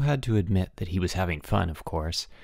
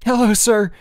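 panting; 12 semitones higher, 7 dB louder than usual. A narrator's reading. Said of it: "he panted".

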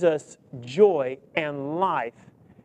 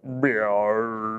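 imitated rumbling stomach growl, low; 8 semitones lower, 4 dB quieter than usual. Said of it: "my stomach went".

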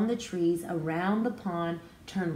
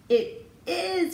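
high, eager shout; shouted back; 11 semitones higher, 4 dB louder than usual. A narrator's reading. Butterfly shouting back to her friend, high and joyful. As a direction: answer back bursting with joy. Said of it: "Farfalina shouted back".

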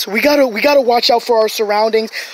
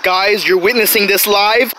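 ordinary delivery

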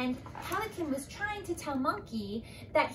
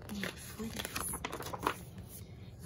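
fearful gasp; 10 dB quieter than usual. Acted. Giraffe trembling.

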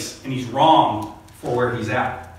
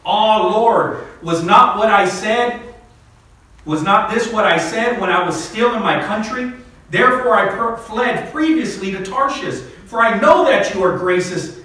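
raised, fervent reading of Jonah's prayer; scripture reading; 5 semitones higher, 5 dB louder than usual. Acted. Preacher reading Jonah's prayer to the congregation, fervent and raised. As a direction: read Jonah's plea up high and loud.

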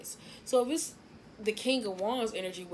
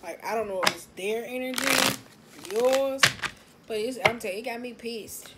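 louder, heated venting about the cards; genuinely pissed off, talking louder; real irritation; 5 semitones higher, 9 dB louder than usual. Real, not acted. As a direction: let the annoyance spill out loud. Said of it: "It got me so mad".

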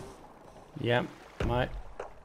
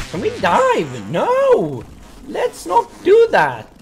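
high-pitched, rising alarm; alarmed refusal; 14 semitones higher, 5 dB louder, slower than usual. Real, not acted. Player backing out of a dive, alarmed refusal. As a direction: build from high question to loud drawn-out protest.

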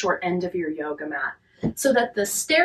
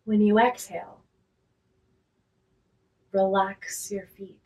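guided relaxation; 5 dB quieter than usual. Real, not acted. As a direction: talk them gently down into rest, unhurried and quiet.

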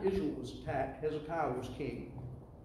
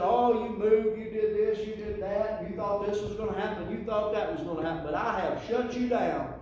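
mocking devil; 5 semitones higher, 5 dB louder than usual.

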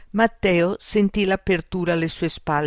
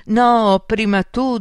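loud, high-pitched scared protest; nervous, raised-voice refusal; frightened insistence; 6 semitones higher, 6 dB louder, slower than usual. Acted. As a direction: throw it straight back in alarm.

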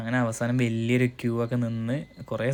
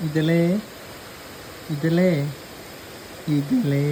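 calling out; much slower than usual. Real, not acted. Calling the child by name.

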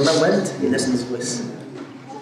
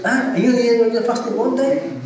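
indignant rebuke, pitched higher; a mock-angry butcher snapping back; angry retort; 8 semitones higher than usual. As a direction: scold him, exasperated and pitched up. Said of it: "The man got angry".

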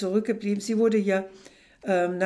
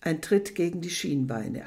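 ordinary delivery

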